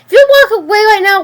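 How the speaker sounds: fast, high, loud threat; angry shouting. Acted. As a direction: yell it fast after him. Riley screams after her boyfriend.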